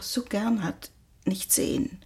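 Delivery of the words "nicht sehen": soft trailing whisper; a gentle hushed whisper. Acted. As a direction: let the bad news drop into a whisper. Mother tells son he can't see father.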